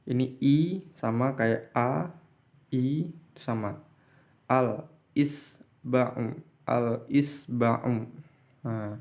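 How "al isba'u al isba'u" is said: "Al-isba'u" drawn out very slowly, l clearly sounded; slow clear demo. Shown as a demonstration. Teacher showing students a clearly read l, very slow. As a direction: stretch "al-isba'u" syllable by syllable with a clear l. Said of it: "ini dibaca jelas la-nya".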